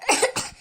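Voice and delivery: sudden high, choking cough; short hacking coughs; coughing fit. Pretend. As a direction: cough as if the throat caught mid-talk. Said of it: "இருமல் நின்றுச்சா?".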